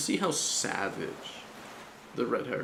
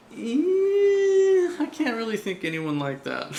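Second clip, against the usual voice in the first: mock whiny crying; 12 semitones higher, 8 dB louder, slower than usual. Imagining his own reluctant answer.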